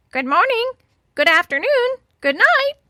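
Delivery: very high, loud parrot squawk; crying parrot. A narrator's reading. Parrot shows off its lessons to the girls, squawking. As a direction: squawk each greeting like a parrot. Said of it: "and cried".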